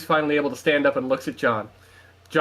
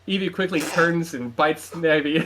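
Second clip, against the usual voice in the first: plain reading voice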